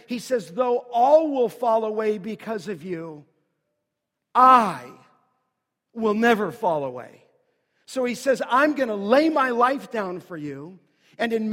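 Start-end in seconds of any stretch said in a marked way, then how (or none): 0.5-7.3 loud preacher's rendering of Peter's boast; emphatic vow. Retold or imitated. Preacher acts Peter's proud boast. 8.5-10.8 loud, emphatic quoted promise; bold pledge. Retold or imitated. Preacher acts Peter's proud pledge.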